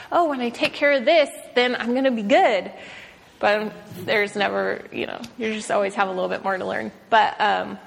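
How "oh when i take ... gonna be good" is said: higher and louder, chirpy mock confidence; a lightly mocking take on our own hopeful thinking; naive self-assured thought. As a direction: play the overconfident inner voice. Voicing our naive inner thought.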